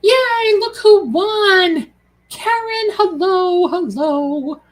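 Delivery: gleeful high announcement of the winner; excited cheer. Real, not acted. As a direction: celebrate the winner out loud.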